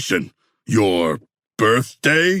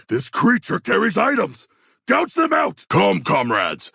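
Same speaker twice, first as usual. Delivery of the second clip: much higher, eager bloodthirsty cry; savage excited shout; 9 semitones higher than usual. Acted. King Shark urging the squad against a creature, savage shout. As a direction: howl the order with relish. Combat order.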